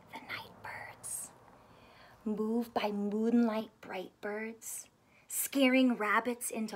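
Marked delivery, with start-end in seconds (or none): 0.1-1.3 breathy whisper of night birds; hushed whisper. Acted. Reading about night birds, whispered softly. 3.8-4.9 whisper. Acted. Night birds section, hushed.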